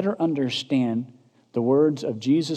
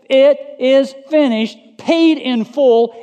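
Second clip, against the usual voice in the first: high, ringing preacher's shout; loud proclamation; 10 semitones higher, 8 dB louder, slower than usual. Acted. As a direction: cry it out loudly, each word drawn out. Proclaims Jesus' last word at full force.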